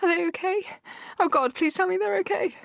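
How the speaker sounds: put-on high, frantic plea for her children; a frightened mother begging for news of her twins; panicked pleading. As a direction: beg to hear they're safe.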